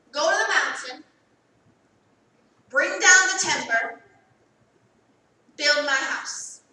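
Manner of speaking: commanding sermon cadence, slowing on build; preaching God's command. Acted. Preacher to congregation, proclaiming God's command. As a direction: proclaim it like the Lord's order.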